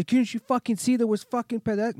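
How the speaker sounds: high, loud angry rant; pissed-off driver. Acted. Angry driver scolding him over pedestrians, acted fury. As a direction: yell indignantly as the irate driver. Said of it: "he's pissed off".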